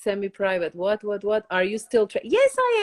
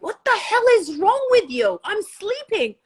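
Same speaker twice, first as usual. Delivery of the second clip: much higher mock of a husband woken from sleep; annoyed husband; 11 semitones higher than usual. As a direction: play the grumpy husband woken up, pitched way up. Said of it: "He's like".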